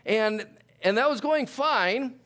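ordinary delivery

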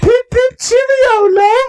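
very high, loud mock British chirp; a hammed-up falsetto British toff; British accent. Mock British greeting to cohost, high and loud. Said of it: "speaking in British accents".

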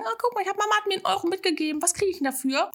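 mimicking a child at the ice cream counter, high and quick; imitating a child. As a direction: chirp it like an eager kid with one euro. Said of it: "Kids vor dir hast, die sagen".

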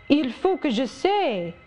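put-on high, eager little French girl's voice; a young girl pleading excitedly in French; Adele crying out. Little Adele crying out to Rochester, eager and high. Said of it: "cried she".

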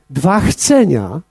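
ordinary talk, nothing marked

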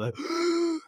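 sharp, high gasp of waking up. Retold or imitated. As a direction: gasp as if your soul rushes back. Waking up from passing out.

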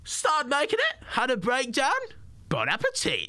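high slow mimic of the comedian heckling him; James Acaster impression. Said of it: "You gotta do the impression".

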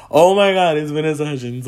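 starstruck. Retold or imitated. Herself spotting [name], starstruck. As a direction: burst out on the first words, then ease off. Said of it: "I was like".

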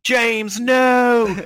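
loud, high cry of dismay; shocked yell. Pretend. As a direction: cry out in horror. Shocked cry at soldiers' death.

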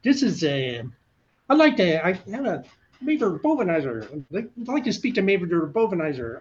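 bluffing phone call in a put-on SNL liar voice; SNL pathological liar voice. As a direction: puff it up like a smug fibbing con man. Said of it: "pathological lawyer from Saturday Night Live".

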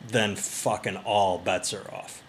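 usual speaking voice

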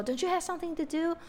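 quick, high, teasing "Don't you have something to do?"; teasing mock scold. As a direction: rattle it off like a playful tease. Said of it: "就像英文说".

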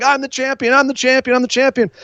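a light take on a giddy champion; mock celebration. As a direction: crow it while dancing around.

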